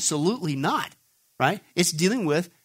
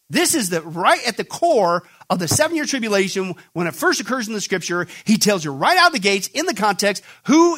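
fiery preaching; 3 semitones higher, 4 dB louder than usual. Acted. Preacher pounding his point to the congregation, loud and emphatic. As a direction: hammer home each point from the pulpit. Preaching builds to full force.

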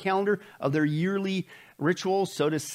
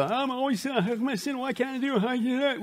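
frustrated believer; 7 semitones higher than usual. Retold or imitated. Preacher mimics a frustrated churchgoer, high and whiny. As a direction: plead it like someone stuck in sin. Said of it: "you sit there and go".

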